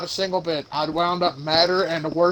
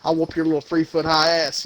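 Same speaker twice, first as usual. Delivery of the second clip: normal talking voice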